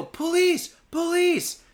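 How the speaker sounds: high, drawn-out re-enacted shout; calling for help. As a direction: hail a passing cop car in a panic. Calls to a passing cop car.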